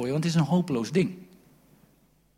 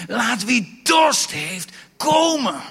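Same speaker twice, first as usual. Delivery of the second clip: high, loud, slow shout; shouted call; 9 semitones higher, 8 dB louder, much slower than usual. Acted. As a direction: call out to the whole crowd. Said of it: "En Jezus riep". (luid roepend, langzaam)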